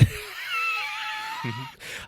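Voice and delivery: big burst of high laughter. Real, not acted.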